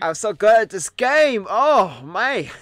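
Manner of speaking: slow, loud, high put-on English accent; mock English accent. Streamer plays English version of herself, mock English accent. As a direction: drag out a bad English boast. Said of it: "wanna do English Sleepy".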